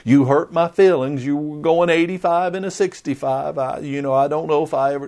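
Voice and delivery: hammed-up whine of a hurt cop; a hint of a weepy officer complaining; mock crying. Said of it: "crying like".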